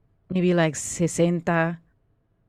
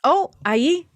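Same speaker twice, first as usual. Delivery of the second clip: high character voice; 9 semitones higher, 4 dB louder than usual. Acted. Carmen answers in Spanish.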